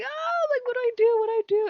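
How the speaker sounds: high, loud mock panic; freaking out. Owner retelling her panic over the lizard, squealing. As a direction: imitate a squealing, helpless panic. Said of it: "she's freaking out".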